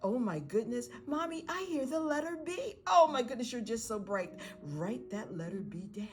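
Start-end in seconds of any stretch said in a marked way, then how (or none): 0.0-2.8 put-on high-pitched child amazed at a discovery; excited child. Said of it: "What do they hear?". 2.9-4.3 put-on high, gushing praise for a child; delighted parent praise.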